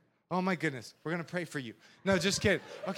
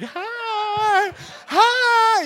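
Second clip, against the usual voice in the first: much higher, louder sing-song hi; parade wave greeting; 11 semitones higher, 7 dB louder than usual. Retold or imitated. Pastor waving from his magazine cart, high cheery greeting. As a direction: greet the crowd like a beauty queen.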